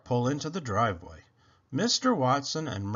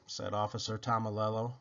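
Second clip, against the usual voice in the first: usual speaking voice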